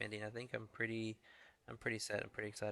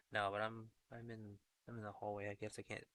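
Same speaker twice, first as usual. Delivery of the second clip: normal talking voice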